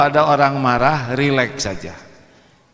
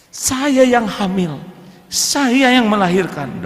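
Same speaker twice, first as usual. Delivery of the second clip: aggrieved wife; 7 semitones higher, slower than usual. Retold or imitated. Wife listing grievances to husband.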